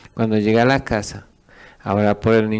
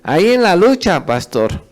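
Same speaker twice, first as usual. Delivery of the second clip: mock churchgoer reply; 8 semitones higher, 6 dB louder than usual. Mimicking churchgoer's stock reply.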